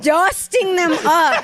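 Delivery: slow, high, laughing disbelief; incredulous laughing repeat. Retold or imitated. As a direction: drag it out mocking the phrase. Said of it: "The fuck is that?".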